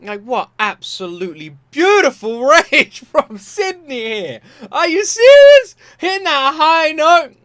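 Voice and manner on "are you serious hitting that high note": much higher, louder disbelief; amazed. Real, not acted. Reacting to the high note, loud amazed shout.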